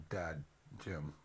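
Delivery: ordinary talk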